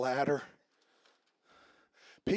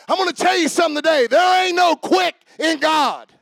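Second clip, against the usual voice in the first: loud, high fiery preaching; 9 semitones higher, 10 dB louder than usual. Acted. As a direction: preach it with full force. Preaching at full force.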